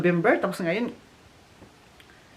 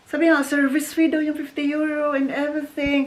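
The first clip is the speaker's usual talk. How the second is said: normal talking voice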